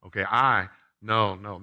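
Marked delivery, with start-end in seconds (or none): 0.2-0.7 "I" hit hard and loud; a loud, leaning "I"; stress on I. Shown as a demonstration. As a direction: punch the word "I" with self-importance. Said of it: "The emphasis is not on I".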